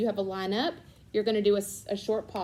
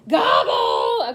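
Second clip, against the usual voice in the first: turkey gobble; 10 dB louder than usual. Pretend. Tutor imitates turkey sound.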